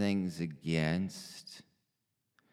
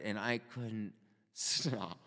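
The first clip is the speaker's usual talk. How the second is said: usual speaking voice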